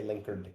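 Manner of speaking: "linkered" run together with a plain final d; "linkered", no separate letter D at the end; Linkerd as one word. Shown as a demonstration. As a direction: say "linkered" in two syllables. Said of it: "I have heard people say linkered".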